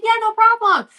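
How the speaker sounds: high-pitched, over-easy agreement; breezy coach. Retold or imitated. Coach agreeing to talk with a parent, breezy. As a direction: imitate an obliging coach, pitch way up.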